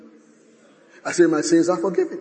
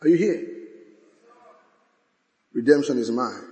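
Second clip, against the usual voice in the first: normal talking voice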